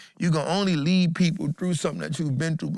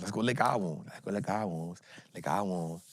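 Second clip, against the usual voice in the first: each repeat sinks lower and softer until it's nearly gone; fading mock retreat; 8 semitones lower, 7 dB quieter than usual. Mimicking the group retreating, fading lower and softer. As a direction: let the voice drain away like a beaten crew slinking off.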